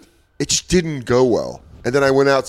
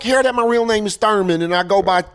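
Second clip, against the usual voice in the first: high-pitched street-slang heckler impression; mock an offended wannabe, pitched way up; hammed-up nineties wannabe; 6 semitones higher than usual. Offended crowd member to comic, hammed-up slangy wannabe voice. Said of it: "That's why I talk like this".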